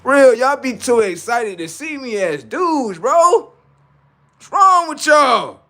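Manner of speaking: high, slow, loud exasperation; exasperated high outcry. Real, not acted. Streamer fed up with starstruck fans, high exasperated outcry. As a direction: throw your voice up in disbelief.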